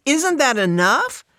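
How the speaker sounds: high, loud indignant retort. A narrator's reading. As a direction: fire it back, pitched right up. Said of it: "Fran raises her voice".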